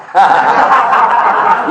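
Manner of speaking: breathy unvoiced laugh; burst of laughter. Real, not acted. Preacher cracking up at the cop asking for prayers, laughing. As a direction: laugh out at the joke.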